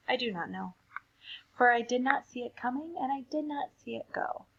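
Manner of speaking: ordinary delivery, nothing marked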